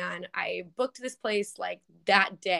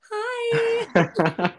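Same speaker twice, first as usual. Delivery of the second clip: high squeaky hi; excited voice leaps up high; voice cracking; 5 dB louder than usual. Real, not acted. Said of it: "I don't know why my voice went up, like, eight octaves".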